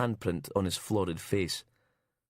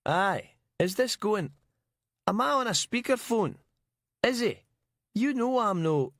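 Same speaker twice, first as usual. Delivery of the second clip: much higher, blaring Glaswegian; high-pitched Glaswegian man on speakerphone; broad Glaswegian accent; 13 semitones higher than usual. A narrator's reading. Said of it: "Colin Miller's broad Glaswegian accent blared out".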